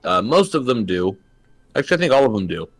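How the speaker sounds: talking with food in mouth; words round a bite of food; mouth full. Real, not acted. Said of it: "I have food in my mouth".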